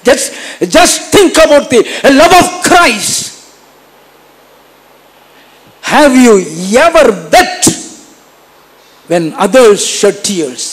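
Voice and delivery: slow, high and loud appeal; pleading preaching. Acted. Preacher urging the congregation to think of Christ's love, slow and loud. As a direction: draw them in with a long plea.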